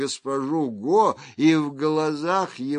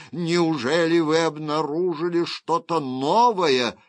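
louder, higher, eager judge; raised, urgent question; excited questioning; 4 semitones higher, 4 dB louder than usual. Acted. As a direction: demand the news with astonishment. Judge exclaims at the news.

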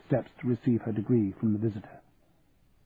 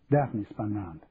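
ordinary delivery

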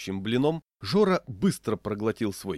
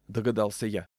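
ordinary delivery

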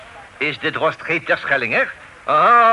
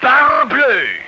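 sharp, higher cry of outrage; indignant exclamation; 8 semitones higher, 4 dB louder than usual. Acted. Officer exclaiming at the stubborn drost, affronted outburst. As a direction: blurt it out, startled and affronted.

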